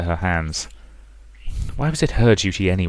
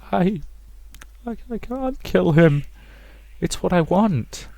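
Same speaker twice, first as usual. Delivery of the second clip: put-on high, timid girl's plea; a scared daughter daring to refuse; frightened girl; 11 semitones higher, 4 dB quieter, slower than usual. Rosa telling her mother she won't kill, timid high plea.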